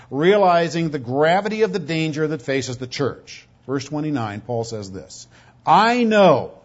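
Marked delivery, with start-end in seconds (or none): 5.6-6.6 I KNOW said louder and higher; emphatic statement. Retold or imitated. Preacher quotes Paul's statement to the church, emphatic. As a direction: hit both words of "I know" with force. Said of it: "it's a very emphatic statement".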